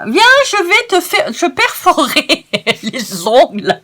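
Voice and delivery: high, loud playful threat breaking into a laugh; mock threat, laughing. Crafter joking to viewers about her slip, laughing playful threat. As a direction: pretend to come at someone's nails, giggling.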